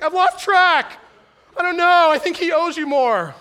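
a bumbling accountant losing track of the count; heavenly accountant fumbling grace's ledger, flustered; mock accountant. Underqualified accountant voiced.

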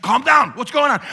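high, loud, urgent; alarmed townsman. Acted. Townspeople trying to calm the herders, urgent shout. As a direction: shout over the panic to get answers.

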